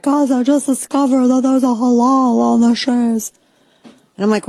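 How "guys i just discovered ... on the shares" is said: higher, louder breathless announcing; mock excited girl. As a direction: play a girl breaking news to her followers. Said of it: "some chick was like".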